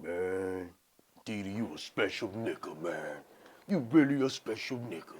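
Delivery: low, quiet admiring imitation; Big E. Friend marveling at the man with two girls, low amused voice. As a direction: play the amused friend, low and drawn-back. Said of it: "Big E was rolling. He was like".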